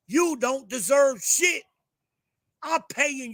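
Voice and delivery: loud, high, slow furious yell; shouted contempt at an entitled driver; angry shout. Real, not acted. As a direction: spit it out, full contempt. Ranting at the driver.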